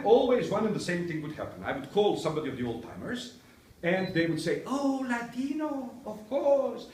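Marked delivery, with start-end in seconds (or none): 4.6-6.9 high, drawn-out delighted recognition; gushing old timer. Retold or imitated. Old timer called to visit the class, gushing.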